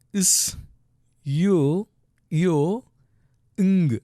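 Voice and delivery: "is", "you", "ng" each held separately; sounding out letters. Shown as a demonstration. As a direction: isolate "is", "you", "ng" with long pauses. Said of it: "look at the second syllable".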